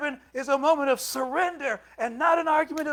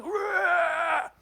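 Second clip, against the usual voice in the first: put-on sudden higher roar at a child; retold angry roar; 5 semitones higher than usual. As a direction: blast a short angry "Rah!". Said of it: "I just, just went".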